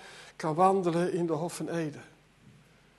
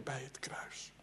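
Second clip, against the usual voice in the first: whisper; 5 semitones lower, 19 dB quieter, slower than usual. Acted. Sudden drop after loud preaching.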